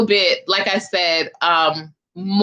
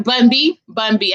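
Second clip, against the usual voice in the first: normal talking voice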